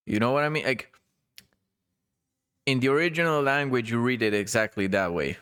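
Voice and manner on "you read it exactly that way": usual speaking voice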